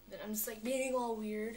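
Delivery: ordinary talk, nothing marked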